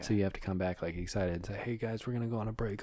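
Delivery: hushed, low coaching; feed someone lines under your breath; very soft, low murmur; whispering off mic. Real, not acted. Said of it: "I'm whispering".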